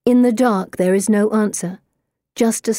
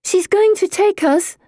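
high, plaintive whine; a bit frightened, whining; scared whine; 12 semitones higher than usual. A narrator's reading. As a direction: plead nervously in a high voice. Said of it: "Pippa whines, sounding a bit scared herself".

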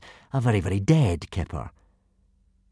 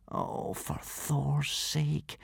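slow, hushed whisper; 5 semitones higher, 11 dB quieter, much slower than usual. A narrator's reading. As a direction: breathe it out under your breath. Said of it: "whispered Hiccup".

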